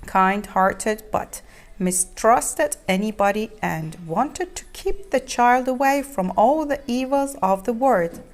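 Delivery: ordinary delivery, nothing marked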